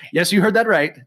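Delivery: ordinary delivery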